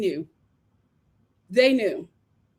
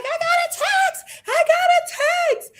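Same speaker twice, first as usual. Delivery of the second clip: high, slow giddy squeal; mock giddy "I got a text"; excited squeal. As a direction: squeal it like a thrilled girl. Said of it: "She's like".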